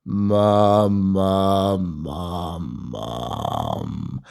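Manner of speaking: relaxed creaky vocal fry on mum. Shown as a demonstration. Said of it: "you go into this nice relaxed vocal fry".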